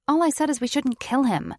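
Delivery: loud, high exasperation; frustrated. A narrator's reading. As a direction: push back in exasperation. Frustrated argument.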